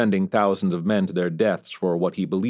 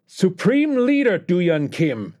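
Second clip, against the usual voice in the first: much higher mocking dictator's whine with a Korean accent; high-pitched whine; 11 semitones higher, 4 dB louder, slower than usual. North Korean leader mocking the US president, high Korean-accented whine. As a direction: sneer and jeer in a shrill Korean-accented whine. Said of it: "the North Korean dictator's cry".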